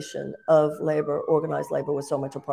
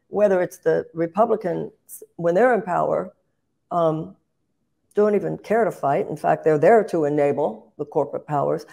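ordinary delivery

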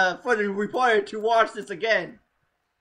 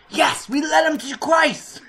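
loud, higher, jubilant retold shout of victory; triumphant cry; 6 semitones higher, 4 dB louder than usual. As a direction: crow it out like you just won. Retold triumph after leading prayer.

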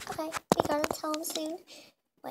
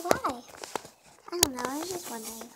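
normal talking voice

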